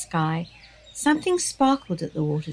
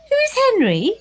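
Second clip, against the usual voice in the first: blurting; 6 dB louder than usual. Acted. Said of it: "blurted out Milo".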